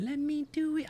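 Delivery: high, squeaky meme imitation with a laugh; mock meme voice. Streamer mimicking a meme they hated, mock high voice.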